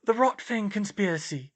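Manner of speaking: high-pitched, earnest statement; utterly earnest about something absurd; solemn conviction in a high voice; very serious. A narrator's reading. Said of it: "she said very seriously".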